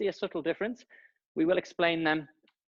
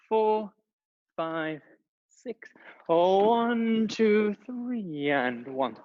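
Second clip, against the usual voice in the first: counting out while dancing; dancing while talking; 5 semitones higher, 3 dB louder, much slower than usual. Real, not acted. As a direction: call the counts mid-dance. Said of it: "very difficult to talk and dance".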